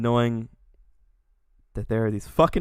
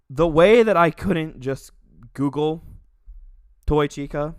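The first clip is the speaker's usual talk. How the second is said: outraged rant; 3 semitones higher, 9 dB louder, slower than usual. Real, not acted. Ranting about lewd search results.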